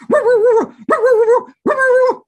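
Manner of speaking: high, loud yappy woo barks; excited high dog bark. Acted. Dog barking in story.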